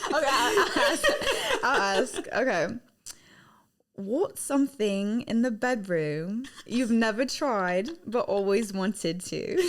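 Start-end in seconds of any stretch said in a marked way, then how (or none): 4.0-9.6 slow, deliberate English accent; English accent impression. Shown as a demonstration. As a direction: put on your best English accent, taking it slowly. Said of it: "your best, your best English accent impression".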